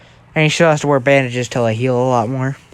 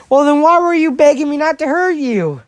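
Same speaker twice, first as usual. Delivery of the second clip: very high, sing-out mock questioning; taunting comeback; 12 semitones higher than usual. Prankster needles Bakugo about his begging, tauntingly. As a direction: needle him in a high put-on voice.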